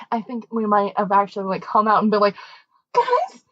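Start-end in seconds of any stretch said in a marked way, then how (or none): 3.0-3.4 high, loud, half-laughing panic. Retold or imitated. Teen girls calling out to chaperones in panic. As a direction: wail it while laughing.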